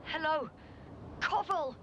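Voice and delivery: rising anxious calls; calling out. Acted. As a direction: call out to the missing crew. Calling out for crew.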